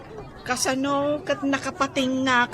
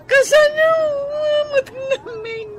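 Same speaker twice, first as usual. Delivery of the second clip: 1 mock crying; 9 semitones higher, 3 dB louder than usual. Woman crying about lost sale.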